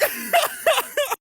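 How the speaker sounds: high peal of laughter; real laugh. Real, not acted. Storyteller amused at being cornered, laughing high. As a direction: crack up after your own reaction. Laughs after saying yikes.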